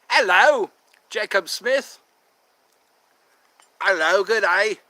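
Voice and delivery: mock posh English host greeting the chat. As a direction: play a genteel English gentleman. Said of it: "I don't know anybody who talks like that".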